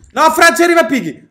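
high, loud warning cry; alarmed shout. Real, not acted. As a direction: yell out a sudden warning. Alarm at monster approaching.

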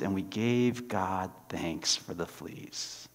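very soft, slow ending. Acted. Preacher finishing the story, very soft. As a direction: let it fade gently. Closing narration softly.

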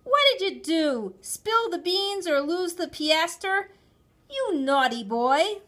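high, loud telling-off; angry, high-pitched parental shouting; mother's shouted scolding. A narrator's reading. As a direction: scold a naughty child at full voice. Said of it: "my mother shouted at me".

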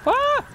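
high sudden shriek; startled shriek. Real, not acted. Diver hit by a jump scare, high shriek.

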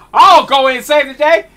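usual speaking voice

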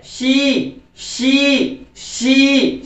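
"शी" with a drawn-out ee; stretched E. Shown as a demonstration. Teacher drills learners, long "शी". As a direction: hold the ee in each "शी". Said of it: "उसको खींच के बोलना है आपको".

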